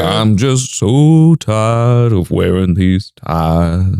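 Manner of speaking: very slow, sleepy weary voice; weary mascot. Acted. Voicing the exhausted tire mascot, very slow and weary. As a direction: drag it out like you can barely stay awake. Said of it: "'Cause he is made of tires".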